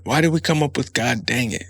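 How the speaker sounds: ordinary delivery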